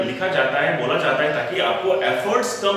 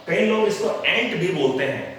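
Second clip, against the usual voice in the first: ordinary talk, nothing marked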